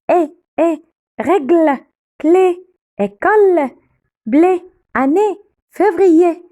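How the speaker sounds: eh, règle, clé, école, blé, année, février high and loud with closed "é". Shown as a demonstration. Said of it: "on ferme la bouche. On dit eh". (« é » fermé, haut et fort : eh, règle, clé, école, blé, année, février)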